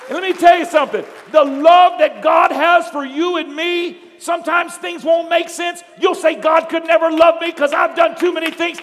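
loud, high, drawn-out preacher's cry; full-force preaching. Real, not acted. Preacher telling the congregation God loves them, shouting fervently. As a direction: build to a shouted exhortation.

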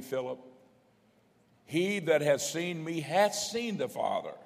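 forceful pulpit reading, stressing key words; scripture proclamation. Acted. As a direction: declaim the verse with mounting force.

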